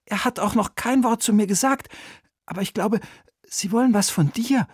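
put-on high, lightly hushed boyish voice; a boy confiding in his aunt. (hohe, leise Jungenstimme)

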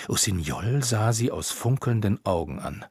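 usual speaking voice

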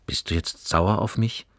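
plain reading voice

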